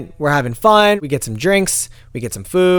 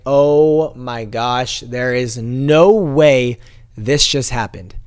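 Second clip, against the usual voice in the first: slow, stretched-out dismay; drawn-out disbelief; 4 semitones lower, much slower than usual. Retold or imitated. Passenger reliving the driver missing the turn, slow disbelief. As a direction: draw each word out in disbelief. Retelling shocked reaction to missed turn.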